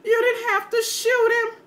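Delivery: high, whiny mock lament; sarcastic imitation of a protesting relative; mocking grieving family. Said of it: "your family wants".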